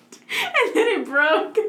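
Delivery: high, drawn-out, giggly admission; real laughing confession. Real, not acted. Admitting to friends the gate broke, high and laughing. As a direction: squeak it out slowly through laughter.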